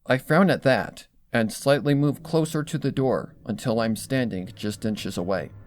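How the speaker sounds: ordinary delivery, nothing marked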